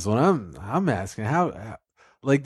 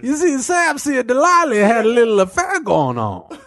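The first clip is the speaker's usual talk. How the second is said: high storyteller voice; 9 semitones higher than usual. Pretend. Narrator character keeps escalating.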